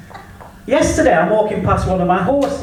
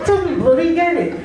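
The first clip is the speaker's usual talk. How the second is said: mock exasperation; 7 semitones higher than usual. Exasperated punchline, raised pitch.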